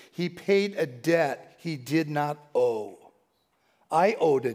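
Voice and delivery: slow, drawn-out chorus line; slow reverent line. Real, not acted. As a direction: savor each word slowly. Recalling sung chorus.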